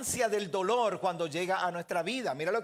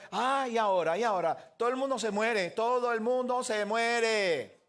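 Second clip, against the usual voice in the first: mocking others' complaint; 4 semitones higher, 3 dB louder than usual. Unbelievers taunt the believer over death, mocking complaint. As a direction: imitate a taunt, stretching the last repeat out. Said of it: "¿Verdad que te dicen así?".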